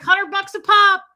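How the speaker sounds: high, loud scalper's hawking call; ticket scalper. Retold or imitated. Dad turns around to sell his son's tickets, scalper's call. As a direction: imitate a scalper calling to the crowd. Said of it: "he becomes a scalper".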